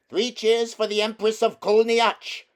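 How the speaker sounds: loud, high mock cheering. A narrator's reading. Voice in the crowd mocking the witch, loud jeering cheer. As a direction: cheer her on in mockery.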